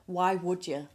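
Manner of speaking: ordinary talk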